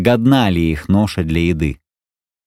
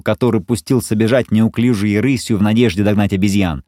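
ordinary delivery, nothing marked

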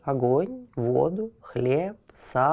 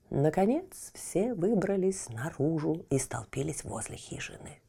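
usual speaking voice

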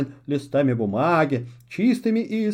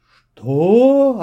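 sudden loud startled witch; taken aback; 5 semitones higher, 9 dB louder than usual. A narrator's reading. Witch stunned by the owl's words, surprised. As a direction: caught completely off guard. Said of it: "опешила Аскольда".

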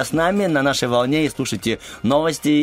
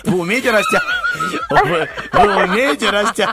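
laughing; 14 semitones higher, 4 dB louder than usual. Real, not acted. Host cracks up mid question.

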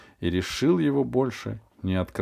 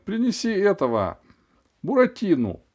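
high, loud call across the room; the dentist calling out in disgust; cry out; 10 semitones higher, 4 dB louder than usual. A narrator's reading. Dentist shouts to nurse for another toy. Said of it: "крикнула врачиха".

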